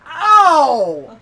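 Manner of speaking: loud, high "ow"; big comic cry of pain; mock yelp of pain. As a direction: yelp it out like a hurt kid, hammed up. Acting out boy hitting hard belly.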